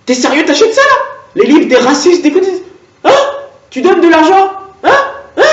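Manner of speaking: high, loud, indignant cashier voice; outraged cashier. Acted. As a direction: play a scandalised cashier scolding him. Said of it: "la caissière qui me guette en mode".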